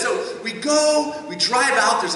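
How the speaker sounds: ordinary delivery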